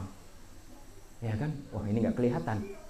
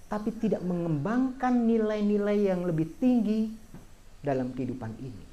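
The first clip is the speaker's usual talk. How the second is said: dhamma preaching; 5 semitones higher than usual. Acted. Monk teaching lay followers about change, earnest preaching. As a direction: lay the teaching on the audience, measured and firm.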